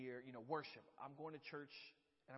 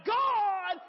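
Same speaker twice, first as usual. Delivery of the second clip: sudden high shouted God; a sudden shout of God to get an amen; preacher's shout; 19 dB louder than usual. Shown as a demonstration. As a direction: belt out God like a fired-up preacher. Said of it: "I'm gonna get real loud".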